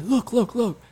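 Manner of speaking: put-on high, eager old man calling look; high excited grandpa voice; imitating grandpa.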